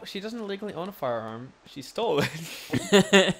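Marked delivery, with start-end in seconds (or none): 1.7-3.3 laughing. Real, not acted. Laughing correction of friend.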